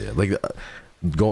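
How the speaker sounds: usual speaking voice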